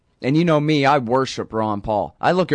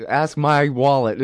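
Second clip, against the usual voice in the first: ordinary delivery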